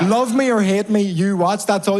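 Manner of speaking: worked-up repeat for a crowd that missed it; exasperated repeat. Acted. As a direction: drive the quote home, indignant. Repeats line to unimpressed crowd.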